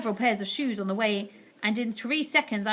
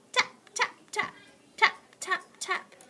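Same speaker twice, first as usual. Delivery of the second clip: tapping; 10 semitones higher than usual. A narrator's reading. Imitating tapping.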